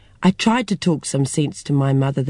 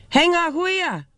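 calling out; 12 semitones higher than usual. Acted. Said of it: "called across the netball courts".

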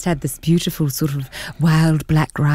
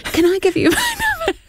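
high eager voice; 14 semitones higher than usual. Retold or imitated. Retold eager ask, very high.

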